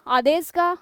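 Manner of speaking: slow, drawn-out आदेश का आ, stretching the आ; drawn-out teaching demo. Shown as a demonstration. Teacher drawing out the आ sound for students. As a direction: spell out आदेश का आ slowly for the class. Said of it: "अधि में अंतिम वर्ण ई".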